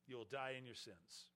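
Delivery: ordinary delivery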